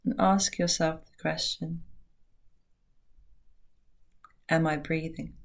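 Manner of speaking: soft, unhurried meditation voice with long pauses; guided meditation. Acted. Teacher guiding listeners into a short meditation, hushed and calm. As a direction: ease them gently into stillness, very softly.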